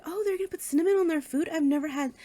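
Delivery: high-pitched, quick wondering reaction; puzzled surprise. Retold or imitated. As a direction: blurt out an innocent, startled thought. Recalling her own surprised thought.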